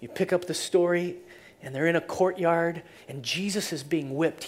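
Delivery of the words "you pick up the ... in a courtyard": usual speaking voice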